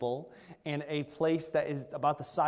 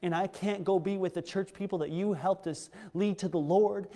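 ordinary delivery

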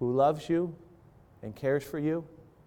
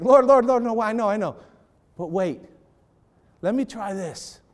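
higher, louder cry of Lord, Lord, Lord, then settling; a vinedresser begging God for more time, pleading loudly; urgent pleading; 7 semitones higher, 6 dB louder than usual. Acted. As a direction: beg for one more chance. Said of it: "she would have said the same thing".

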